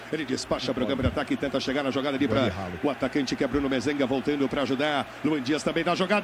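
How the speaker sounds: fast radio play-by-play; live play-by-play. Acted. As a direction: call the action quickly.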